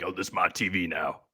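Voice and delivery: mock boast. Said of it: "That's what you sounded like last week".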